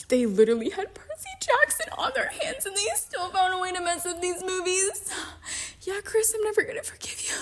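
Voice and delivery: very high, strained squeal of complaint; squeaky fan outrage. Pretend. Fan scolds director Chris, in squeaky outrage. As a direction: rant in a strangled high squeak.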